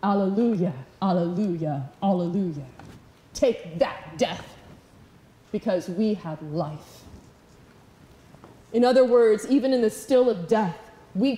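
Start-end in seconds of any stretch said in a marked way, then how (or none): 0.0-2.6 low, understated alleluias, each falling; sarcastic song. Acted. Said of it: "we make our sarcastic song". 3.3-7.0 low, soft, understated defiance; a quiet taunt, dropped low and slowing; mocking death. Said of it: "we're mocking death".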